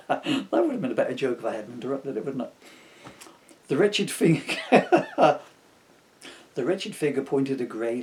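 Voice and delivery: ordinary talk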